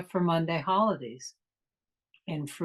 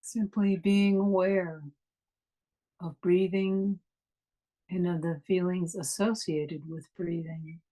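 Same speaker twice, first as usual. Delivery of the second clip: guided meditation. Acted. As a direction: ease them inward, unhurried and calm.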